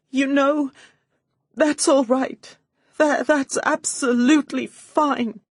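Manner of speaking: high, loud put-on sobbing; through sobs. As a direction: sob it out for show. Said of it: "I said through my sobs".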